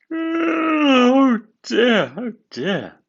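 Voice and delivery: high drawn-out sleepy groan; yawning engine waking late. A narrator's reading. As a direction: stretch it out like a big yawn. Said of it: "he yawned".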